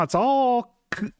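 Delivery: ordinary talk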